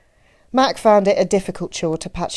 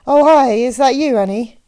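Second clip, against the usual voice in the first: loud, high mock surprise; playful, over-bright phone greeting; teasing; 6 semitones higher, 8 dB louder than usual. A narrator's reading. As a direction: feign surprise to tease her. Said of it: "He couldn't resist".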